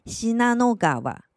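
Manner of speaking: 信濃川 read slowly as "Shinanogawa"; slow Japanese "Shinanogawa" for 信濃川; Japanese reading gawa. Shown as a demonstration. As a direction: say 信濃川 as "shi-na-no-ga-wa", voiced g. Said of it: "信浓川念成".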